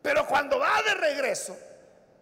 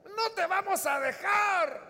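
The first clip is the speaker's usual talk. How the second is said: crying protest; 9 semitones higher, 3 dB louder, slower than usual. Acted. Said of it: "comenzaron a llorar".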